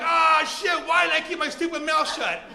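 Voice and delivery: loud, high mock panic; panicked regret. Owner as the thief charges back, panicked regret. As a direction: wail in dismay at your own mistake.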